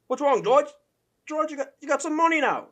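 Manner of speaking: high, loud mock British accent; Michael Bisping accent. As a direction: put on a hammed-up British accent. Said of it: "I can't do his accent".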